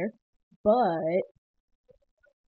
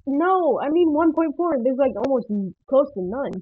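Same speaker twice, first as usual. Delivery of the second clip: loud, higher objection; raised voice, acting out a frustrated reaction; exasperated retold protest; 5 semitones higher, 7 dB louder than usual. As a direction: protest loudly at out-of-date tutorials. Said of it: "And I'm like".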